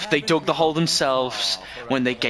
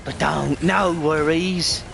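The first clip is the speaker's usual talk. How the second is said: put-on slow, drawn-out Scottish accent; playfully quoting the boss in a Scottish accent; mock Scottish accent; slower than usual. Streamer quoting the Dingodile boss line, in a mock Scottish accent. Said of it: "Nah, Scottish lingo".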